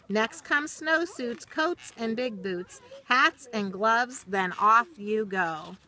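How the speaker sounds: lilting, rhythmic storybook read; reading to children. Acted. Teacher reading a rhyming book to children, storybook lilt. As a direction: read the rhyme slowly to little listeners, sing-song. Reads picture book aloud.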